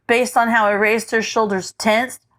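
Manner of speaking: usual speaking voice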